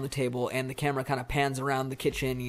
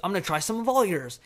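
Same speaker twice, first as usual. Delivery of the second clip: acted heated outburst, higher-pitched; mock-angry retort, pitched up; angry father; 6 semitones higher, 3 dB louder than usual. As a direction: announce it in a raised, fed-up voice. Acting the angry father at dinner.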